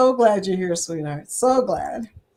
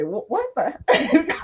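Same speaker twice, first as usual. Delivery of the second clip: slow, high, baffled stammer; stunned disbelief; 6 semitones higher, slower than usual. Pretend. Viewer reacting to her channel, slow baffled stammer. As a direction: trip over the words in mock shock. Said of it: "you're going to just go".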